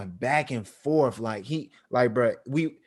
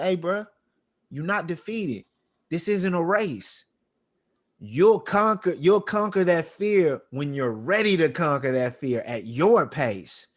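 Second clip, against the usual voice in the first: higher, earnest encouragement; reassuring dad; 6 semitones higher than usual. Retold or imitated. Dad to defeated son, earnest and reassuring. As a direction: lift him up gently but firmly.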